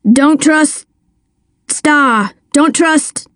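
thin, broken voice over a bad line; words cutting in and out on a phone; scratchy phone line. A narrator's reading. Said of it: "came through scratchy and thin".

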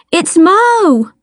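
high-pitched urgent cry; cried out. A narrator's reading. Child naming herself to the armed detective, cried out. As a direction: cry out to be recognised. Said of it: "I cried".

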